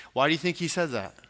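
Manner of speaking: normal talking voice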